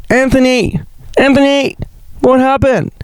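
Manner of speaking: high, concerned calling out; friend's voice. Retold or imitated. Friend Cairo calling to Anthony outside the bar, high and concerned. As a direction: call his name up high, worried. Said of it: "She goes".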